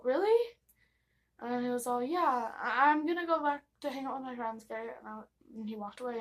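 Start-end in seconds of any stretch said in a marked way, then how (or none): 0.0-0.6 high excited really; delighted surprise. Retold or imitated. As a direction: squeal it in disbelief. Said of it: "I was all".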